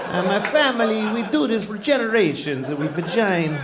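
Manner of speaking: high, broken-English Italian craftsman; mock Italian accent. Italian table maker boasting about his family craft, thick mock accent. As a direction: boast proudly like an old Italian carpenter.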